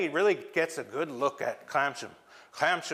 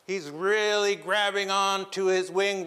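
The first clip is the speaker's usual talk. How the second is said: ordinary delivery, nothing marked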